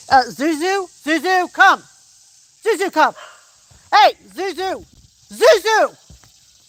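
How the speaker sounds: loud, high repeated shouts; calling the dog. Real, not acted. Owner shouts for runaway dog Zuzu, loud calls. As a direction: holler her back across the field.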